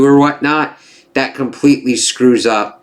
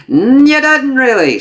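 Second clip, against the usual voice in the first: skeptical correction; 6 dB louder than usual. Retold or imitated. Contradicting a boast about the mole, skeptical. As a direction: imitate someone pushing back with a raised voice.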